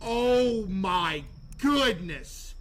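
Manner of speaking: very slow, stretched-out oh my goodness; drawn-out groan. Real, not acted. Streamer reacting to a suspect, slow and drawn out. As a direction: drag each word out slowly.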